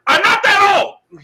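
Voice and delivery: slow, loud and high protest; exasperated. Real, not acted. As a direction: lean into the exasperation.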